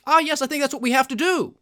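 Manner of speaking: a hammed-up, self-satisfied insider; mock smug official. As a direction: parody the officials deciding. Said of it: "that allows them to say".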